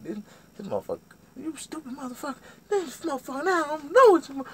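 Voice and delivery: high-pitched ranting imitation; cussing out. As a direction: imitate her nonstop cursing in a squeal. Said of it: "cussing me out all kind of ways".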